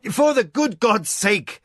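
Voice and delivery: high, loud, slow exclamation; the master exclaiming at his prostrate staff, high, loud and slow; exclaimed. A narrator's reading. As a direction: exclaim in exasperation. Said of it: "Hokanu exclaimed".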